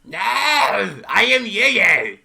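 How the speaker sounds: loud, high, drawn-out screech; screeching creature. Acted. Cornered creature answers the adventurer's challenge, screeching. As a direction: howl it slowly in a high creature voice.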